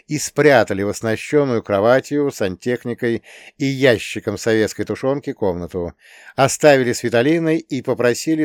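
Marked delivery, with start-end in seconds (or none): none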